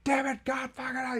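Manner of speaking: high strained mock yell; hammed-up rage at the car; imitated meltdown. Host imitating Mike hitting his car, strained mock yell. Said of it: "he's like".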